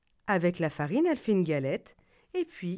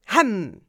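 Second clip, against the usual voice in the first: loud chomp; 8 dB louder than usual. Acted. Fox gobbles the galette.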